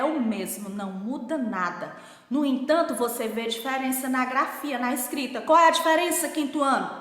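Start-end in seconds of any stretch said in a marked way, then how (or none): none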